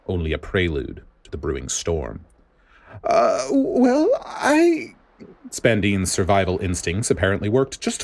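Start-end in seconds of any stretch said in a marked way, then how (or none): 3.0-4.9 much higher, panicked stammer cut short; a cornered man scrambling for an excuse; frightened stammer. A narrator's reading. Director Spandine stammering in fear at the furious vice admiral, much higher. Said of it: "he stammered for an excuse".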